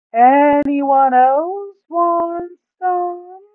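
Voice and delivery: high, loud, slow put-on voice for the greedy Duke. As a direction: drag the words out, high and loud, savouring the loot. Duke offers crystals.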